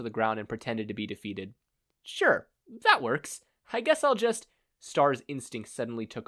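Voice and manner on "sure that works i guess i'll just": shrugging. A narrator's reading. Hero shrugs, distracted.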